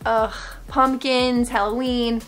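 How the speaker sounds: ordinary talk, nothing marked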